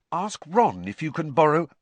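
Hermione voice. A narrator's reading.

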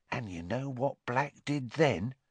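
usual speaking voice

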